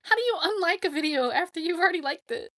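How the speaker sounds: high, loud, baffled outburst. Retold or imitated. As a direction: sound shocked and confused. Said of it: "I was like".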